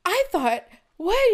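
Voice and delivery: high startled what; squeaky stunned outburst; shocked disbelief. Retold or imitated. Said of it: "he was like".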